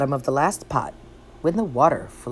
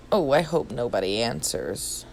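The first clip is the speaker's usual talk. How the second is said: lower, softer nervous mumble; hope nobody hears you; frightened Pooh to himself; nervous aside; 6 semitones lower, 6 dB quieter than usual. A narrator's reading.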